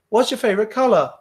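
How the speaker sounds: a worn-out examiner on autopilot, fast; bored, rattled-off question. Retold or imitated. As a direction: rattle it off, numb from repetition. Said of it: "examiner repeating".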